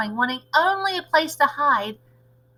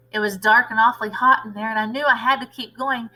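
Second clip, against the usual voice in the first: usual speaking voice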